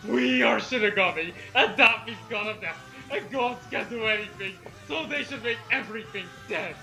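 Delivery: ordinary talk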